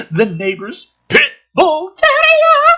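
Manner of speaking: high, slow, loud announcement of the dog; milk the reveal like a horror twist; mock dramatic reveal.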